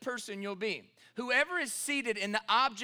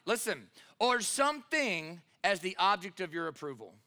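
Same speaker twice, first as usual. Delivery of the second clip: ordinary delivery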